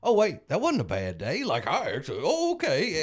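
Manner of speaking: mock surprised realization, rising high at the end; grinning realization. Newcomer realizing a bad day was a win, mock surprise. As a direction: play the dawning aha lightly. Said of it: "you see a guy grin and go".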